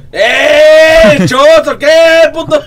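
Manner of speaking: high, loud rowdy heckling; drunken yell. Retold or imitated. Mocking the crying friends, a loud high rowdy yell. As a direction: hurl it like a drunk buddy across the yard. Retells friends yelling at them.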